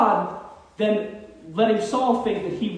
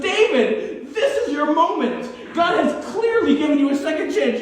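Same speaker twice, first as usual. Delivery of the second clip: eager laughing urging; 6 semitones higher than usual. Acted. Abishai urges David at sleeping Saul.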